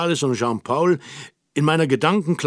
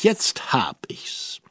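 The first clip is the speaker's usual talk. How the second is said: geflüstert; 2 semitones lower, 3 dB quieter, much slower than usual. A narrator's reading. Plötzlicher Einfall, freudig.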